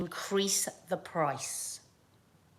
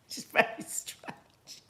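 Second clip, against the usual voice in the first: laughing whisper; 6 dB quieter, slower than usual. Real, not acted. Laughing aside.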